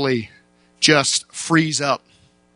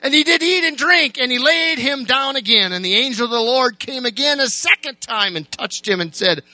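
preaching at full force; 9 semitones higher than usual. Acted. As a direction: drive the story home to the church, raised high.

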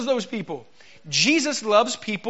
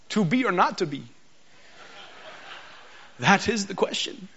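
mock Shakespearean; 4 dB quieter than usual. Shown as a demonstration. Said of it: "I read it like this".

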